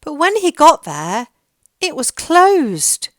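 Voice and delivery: ordinary delivery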